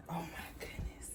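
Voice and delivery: breathless whispered wonder; awed whisper. Real, not acted. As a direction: whisper it in awe. Awed as it glows in dark.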